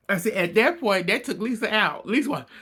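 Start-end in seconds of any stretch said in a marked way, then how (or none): none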